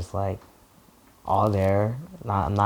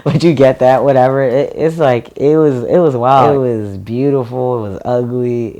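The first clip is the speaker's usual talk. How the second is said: excited; 3 semitones higher, 10 dB louder than usual. Real, not acted. Excited recalling first Pride.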